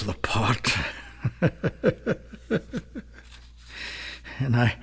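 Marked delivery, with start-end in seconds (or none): 1.2-3.0 breathless laugh. Acted.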